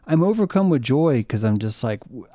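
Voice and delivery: normal talking voice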